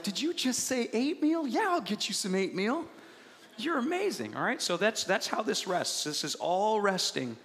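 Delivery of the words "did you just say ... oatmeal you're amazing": higher, doting excitement; delighted father. Retold or imitated. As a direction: indulge the child, "oatmeal" as "eight-meal". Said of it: "Your father's like".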